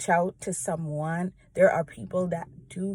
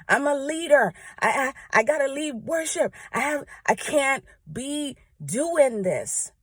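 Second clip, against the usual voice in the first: higher-pitched retelling of her own inner protest; inner panic; 6 semitones higher than usual. Retold or imitated. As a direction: replay the frantic thought in your head, pitched up. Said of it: "in my mind".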